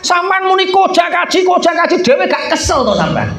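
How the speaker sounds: high, mocking shouted complaint. Wife scolding husband shouting kaji.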